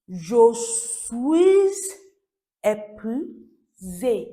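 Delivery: "suis" stretched long and loud into a z; very slow "Je suis", pause, "épuisé" syllable by syllable; exaggerated liaison model. Shown as a demonstration. Teacher stressing pronunciation, "Je suis épuisé" hugely drawn out. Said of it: "this is pronunciation hack".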